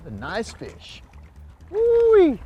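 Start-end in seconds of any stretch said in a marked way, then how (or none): 1.7-2.4 high joyful woo-wee; excited whoop. Real, not acted. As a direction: cheer as the fish comes in. Whoop landing fish.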